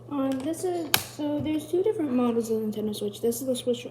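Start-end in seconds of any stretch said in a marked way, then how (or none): none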